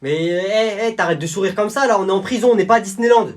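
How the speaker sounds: scolding prison guard. Acted. Guard scolding a smiling prisoner at the photo. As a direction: tell the grinning inmate off.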